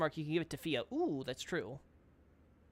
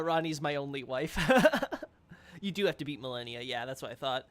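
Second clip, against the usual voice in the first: ordinary talk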